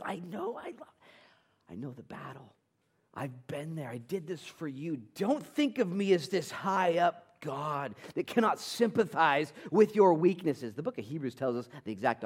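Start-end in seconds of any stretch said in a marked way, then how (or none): 0.0-5.0 soft, high, hushed compassion; quiet, aching sympathy; tender reassurance. Acted. God tenderly reassures the struggler. 5.1-10.7 slow, high, loud preaching plea; emphatic plea. Acted. God's words preached at full force.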